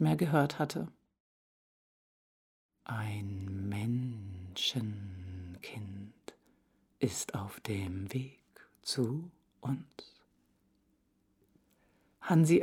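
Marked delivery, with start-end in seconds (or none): 2.8-9.8 low, slow, hushed eagle proclamation; solemn announcement. Acted. Eagle proclaiming to the animals, low and hushed. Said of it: "verkündete".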